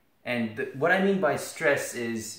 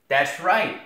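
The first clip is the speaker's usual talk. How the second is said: mock triumphant; 5 semitones higher, 7 dB louder than usual. Answering own rhetorical question.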